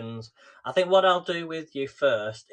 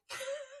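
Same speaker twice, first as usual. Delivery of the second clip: high giggle; 13 dB quieter than usual. Real, not acted. Laughing at self on screen.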